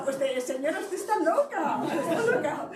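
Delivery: high, laughing imitation of the girl; laughing mock girl. The crying girl answers the speaker, high and laughing. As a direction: play the girl's retort while laughing. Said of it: "Me había dicho".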